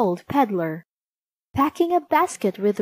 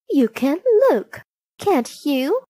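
high, louder wheedling question; coaxing queen; 6 semitones higher, 4 dB louder than usual. A narrator's reading. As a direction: tempt her as the disguised queen. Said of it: "said the queen".

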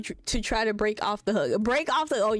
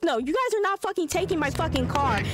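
acted indignant refusal; 6 semitones higher than usual. Voicing quarrel with tow driver.

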